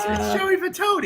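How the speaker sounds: high-pitched call without much volume; mock a fan calling from afar; imitated distant yell. Friend retelling somebody spotting Joey in the car, mock yelling. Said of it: "somebody yells".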